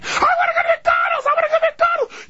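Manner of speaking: put-on very high, wailing child; screaming kid. Kid begs parent for McDonald's, screaming. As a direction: shriek a child's demand. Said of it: "their eight screaming kids".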